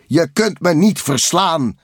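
high, loud taunt; defiant villain. A narrator's reading. Rival Kabuto to Takel on the road, loud and defiant. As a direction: throw it down as a forceful challenge.

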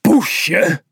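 poesje thrown back high and loud, falling; indignant. A narrator's reading. The insulted cat repeats the taunt, indignant.

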